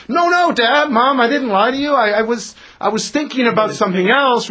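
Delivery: put-on a little kid protesting to a parent; little child's excuse. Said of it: "imagine your little son or daughter".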